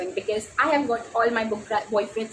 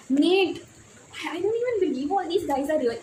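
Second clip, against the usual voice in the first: swooning; 7 semitones higher than usual. Real, not acted. Reader gushing to viewers about her book crush, swooning. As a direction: gush about him, melting. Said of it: "gushing over fictional crush".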